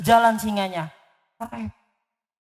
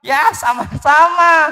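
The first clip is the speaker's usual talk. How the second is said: mouse voice; 4 semitones higher, 6 dB louder, slower than usual. Acted. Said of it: "Eh, bukan".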